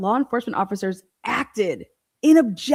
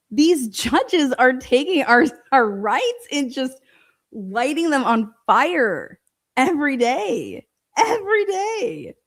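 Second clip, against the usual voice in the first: exasperated rant; 3 semitones higher, slower than usual. Real, not acted. Ranting about judges in exasperation.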